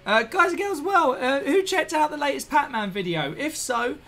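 high and lively; excited greeting. Real, not acted. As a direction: call out to the chat, bright. Greeting stream viewers.

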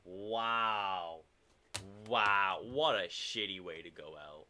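usual speaking voice

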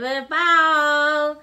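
loud, high and slow cheer; slow, loud, high ringmaster call; drawn-out call. Real, not acted. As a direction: stretch it out big like a ringmaster. Parent calls for bow.

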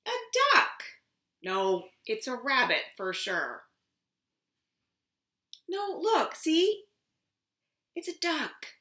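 lively storybook voice, high and loud; reading to a toddler. Acted. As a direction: read it big for little ones. Reads picture book aloud.

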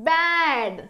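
"బ్యాడ్" with long vowel, falling pitch; pronunciation demo. Shown as a demonstration. Teacher modelling how it is read, "బ్యాడ్" drawn out. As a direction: stretch "బ్యాడ్" as one blended word. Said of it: "ఎలా పలుకుతుంది".

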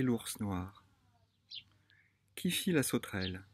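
measured recital with long pauses; poem reading. Acted. Sharing a summer poem with viewers, poem reading. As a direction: read the poem line by line, unhurried.

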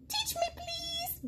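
very high, slow, small voice; a little creature begging sweetly; tiny squirrel plea. Acted. The smallest squirrel begs Gerald to teach it.

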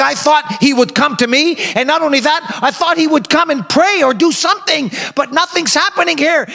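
high, pressing complaint of a slighted general; indignant Naaman. Acted. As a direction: let the general's wounded pride rise up high. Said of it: "Naaman is saying".

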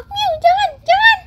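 pleading cat voice. Acted. Fluffy the cat begs the kids not to dye it, high plea. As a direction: beg like a frightened kitten.

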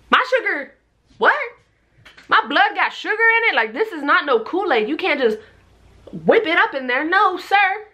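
high, incredulous outrage; squeaky what-do-you-mean disbelief; shocked disbelief. Retold or imitated. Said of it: "And I was like".

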